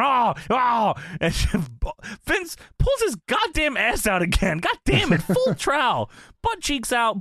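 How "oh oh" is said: high mock wail; imitated yelp of protest. Commentator forced down onto his knees, high hammed-up wail. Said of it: "J R's going".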